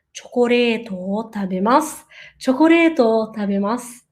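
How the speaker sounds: "Chocoreto" with a tapped r, "tabemasu" dropping low and said as "tabemas"; pronunciation demo. Shown as a demonstration. Teacher repeats "Chocoreto, tabemasu" for students to imitate, demo. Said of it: "Procure imitar a pronúncia".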